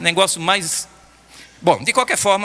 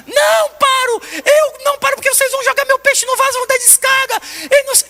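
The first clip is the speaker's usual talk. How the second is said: put-on much higher, screaming child; desperate child scream; 3 dB louder than usual. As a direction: shriek it like a panicked little girl. Granddaughter screaming in despair.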